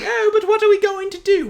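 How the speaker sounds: put-on high squeaky child in a panic; panicky kid voice. Kids panicking in the story.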